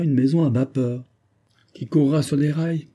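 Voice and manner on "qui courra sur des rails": raised scoffing question; shrugging scoff; dismissive exclaim. A narrator's reading. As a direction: scoff at the idea. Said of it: "s'écria le capitaine en haussant les épaules".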